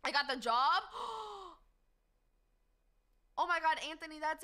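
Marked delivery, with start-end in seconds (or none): 0.9-1.5 sharp high gasp; surprised gasp. Real, not acted. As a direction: gasp in delight at news. Hearing job news.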